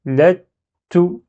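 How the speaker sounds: "Let", stop, then short "to" said "tou"; "let...tou" with a short vowel; shadda with dhamma. Shown as a demonstration. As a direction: block the t of "Let" before releasing "to". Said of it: "ça m'empêche de prononcer dhamma directement".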